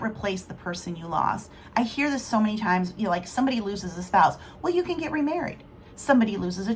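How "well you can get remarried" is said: higher, fast mock of glib advice; mock tactless advice. Mocking people who tell a widow to remarry, glib. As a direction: parody someone offering glib comfort.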